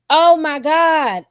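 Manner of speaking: hammed-up astonished outcry; retold shock. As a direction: drag it out in stunned disbelief. Retells her shocked reaction.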